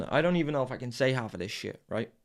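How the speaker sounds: normal talking voice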